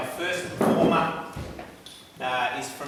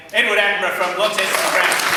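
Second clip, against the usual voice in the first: announcer call; 6 semitones higher, 10 dB louder, faster than usual. Real, not acted. Announcing the first auctioneer.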